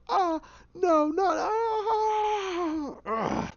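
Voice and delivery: high, long anguished wail; agonised cries. Retold or imitated. Man fighting off the Hatter, agonised wailing. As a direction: wail while fighting something inside your head. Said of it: "he grabs the head and goes".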